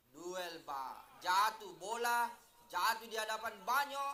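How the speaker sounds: ordinary talk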